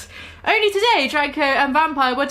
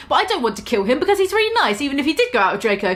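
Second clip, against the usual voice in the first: fast rush; much faster than usual. Acted. Frantic rushed rant.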